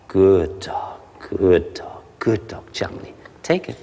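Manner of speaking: low soft praise. Shown as a demonstration. Trainer praising the puppy softly. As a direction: reward the dog gently. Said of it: "So we say".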